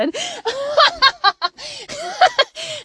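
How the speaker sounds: high burst of real laughter. Real, not acted. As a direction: laugh out loud at herself. Laughing at herself.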